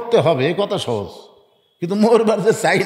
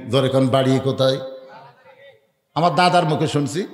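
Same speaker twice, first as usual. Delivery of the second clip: ordinary talk, nothing marked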